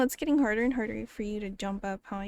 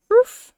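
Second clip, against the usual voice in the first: roof barked high and loud; 12 semitones higher, 14 dB louder than usual. Retold or imitated. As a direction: yelp it like a small dog. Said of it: "What noise do they make?".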